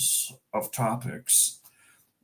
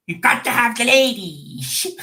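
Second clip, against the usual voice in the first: higher, louder put-on landlord voice; 10 semitones higher, 5 dB louder than usual. Landlord voice requested by chat, cheeky. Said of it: "The landlord voice".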